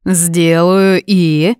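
slow, drawn-out prompt with a rise; king mockingly prompts the secretary to add his title, slow. A narrator's reading. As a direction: make him finish the phrase.

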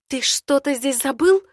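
hissed, irritated question; hissed annoyance. Acted. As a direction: hiss it at him. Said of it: "шиплю на него недовольно".

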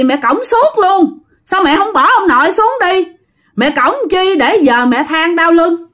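put-on the child telling his mother to put grandpa down, high kid voice; child's voice. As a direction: talk up high like a small kid stating the obvious. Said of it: "nó trả lời một câu xanh dờn".

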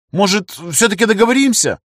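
высоко, заискивающе; жалобно упрашивая, высоким голосом; умоляюще. Acted. Загнанный в угол умоляет. (умоляюще, высоким голосом)

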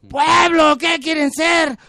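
high, shouted, stretched question; grito al pueblo. Acted. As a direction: bellow to the crowd, dragging out the last word. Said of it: "grita al pueblo". (arenga a gritos, aguda y lenta)

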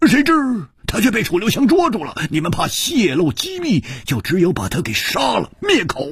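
high, fast unmasking; excited accuser Hu. Acted. Hu revealing why the killer was silenced, excited. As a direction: race through the deduction at a high pitch.

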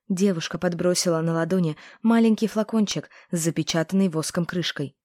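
ordinary delivery

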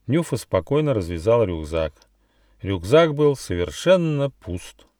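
ordinary delivery

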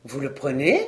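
low-voiced surprise, rising at the end; wide-eyed mayor's question, pitched low; astonished. A narrator's reading. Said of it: "dit le maire en ouvrant de grands yeux". (étonné, voix grave qui monte à la fin)

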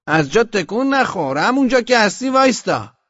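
high, loud halt command; shouted sentry order. A narrator's reading. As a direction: order the captain to freeze where he is. Sentry orders captain not to move. (فرمان ایست با فریاد)

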